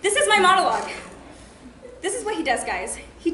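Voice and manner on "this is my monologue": raised, indignant scolding. Acted. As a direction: snap at the boyfriend for interrupting. Acted scolding of interrupting boyfriend.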